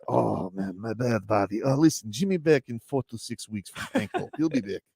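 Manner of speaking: put-on a light Slovenian accent, sheepish and casual. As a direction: shrug it off in a mild Slovenian accent. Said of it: "I know you do the accent".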